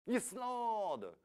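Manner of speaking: high, loud triumphant answer; excited reveal. Real, not acted. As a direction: announce it like a winner.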